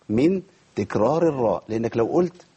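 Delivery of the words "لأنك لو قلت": ordinary talk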